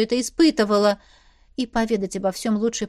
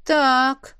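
drawn out; 4 semitones higher, 5 dB louder than usual. A narrator's reading. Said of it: "протянула Ализер".

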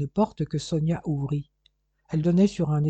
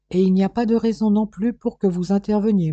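louder, higher retort; raised-voice protest; 5 semitones higher, 6 dB louder than usual. A narrator's reading. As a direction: object forcefully to the interference. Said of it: "protesta le policier".

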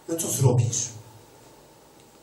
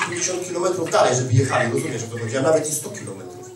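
normal talking voice

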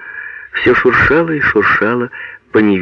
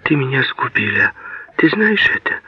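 put-on higher, half-whispered boyish reproach; a touch of a passionate child, pitched up; childlike passion; 6 semitones higher than usual. A narrator's reading. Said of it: "С детским страстным выражением говорил Казанок".

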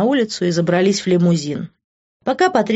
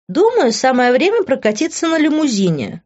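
drawling; 8 semitones higher than usual. A narrator's reading. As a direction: stretch it out in a higher voice. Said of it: "протянула Кристал". (протяжно, высоким голосом)

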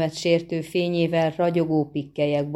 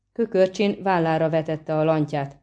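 plain reading voice

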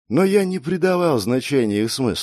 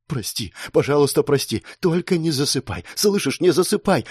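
distraught, rising into higher urgency; trembling plea; 4 semitones higher than usual. Acted. Girl begs dying Mark's forgiveness, trembling plea. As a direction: beg him tearfully to stay awake. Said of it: "прошептала она дрожащим голосом".

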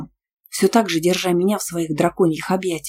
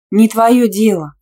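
loud, higher, slow rebuff; snorting retort; 4 semitones higher, 8 dB louder, slower than usual. A narrator's reading. Student rebuffing Tima's question about a boyfriend, huffily. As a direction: snap it back with a huff. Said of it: "тут же фыркнула я".

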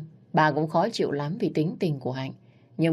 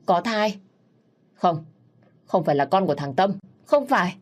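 rising higher, louder panicked no; shocked denial; 4 semitones higher, 4 dB louder than usual. Acted. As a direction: deny it in horrified disbelief.

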